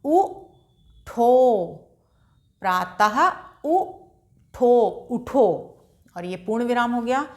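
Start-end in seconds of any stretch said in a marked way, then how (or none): 0.0-5.5 very slowly stretched प्रातः उठो; slow drawn-out reading. Shown as a demonstration. Teacher slowly reads a sentence for children, drawn out. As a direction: draw each syllable out like a patient teacher. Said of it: "हा के संग उसको बोलेंगे".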